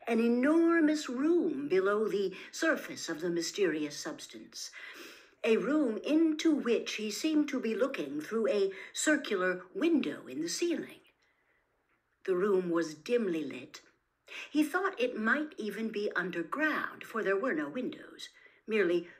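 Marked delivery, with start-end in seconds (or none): none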